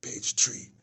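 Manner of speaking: loud, sharp page number; retold call-out. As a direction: bark out the page like the man did. Retold man reading file page.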